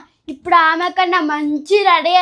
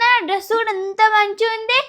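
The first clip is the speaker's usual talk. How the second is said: ordinary talk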